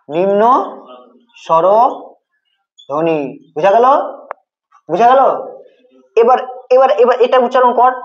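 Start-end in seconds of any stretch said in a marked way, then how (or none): 0.1-3.2 নিম্ন, long pause, then স্বরধ্বনি in broken pieces; slow dictation. Shown as a demonstration. Teacher dictates term word by word. (নিম্ন স্বরধ্বনি থেমে থেমে, ধীরে)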